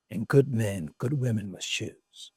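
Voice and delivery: slow, low, solemn elder; lightly voiced Mandela. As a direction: deliver it like wise counsel, slowly. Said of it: "And he says".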